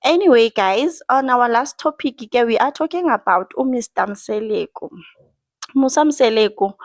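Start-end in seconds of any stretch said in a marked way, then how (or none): none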